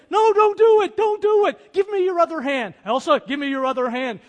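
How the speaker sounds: mock-frantic shout to save her; Indy yelling. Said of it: "And he goes".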